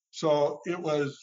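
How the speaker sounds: usual speaking voice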